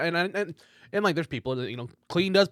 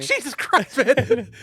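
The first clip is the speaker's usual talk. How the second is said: loud, high exclamation through laughter; real shocked cry, pitched way up; shocked outburst; 10 semitones higher, 7 dB louder, much slower than usual. Real, not acted. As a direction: burst out in laughing disbelief. Shocked reaction to the story.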